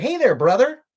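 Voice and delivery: mock the other man's eager reply. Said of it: "He's like".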